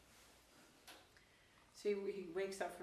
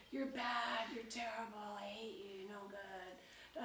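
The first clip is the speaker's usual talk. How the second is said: mock screaming; 3 semitones higher, slower than usual. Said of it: "the mouth is screaming at him".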